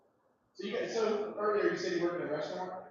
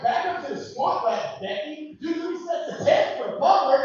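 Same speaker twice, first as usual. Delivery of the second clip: higher, louder coach yelling orders through a laugh; mock gym coach bark; 9 semitones higher, 9 dB louder, slower than usual. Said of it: "I'm like".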